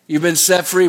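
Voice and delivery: ordinary talk, nothing marked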